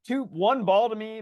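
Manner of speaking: ordinary delivery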